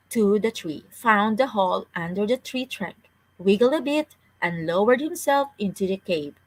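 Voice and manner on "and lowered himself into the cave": usual speaking voice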